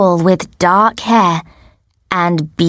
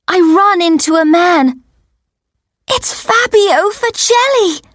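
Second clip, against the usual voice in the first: high-pitched amazement; breaking the news in a high, astonished voice; starstruck shock; 10 semitones higher than usual. A narrator's reading. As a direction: tell it as a thrilling shock. Narrator bumps into her idol.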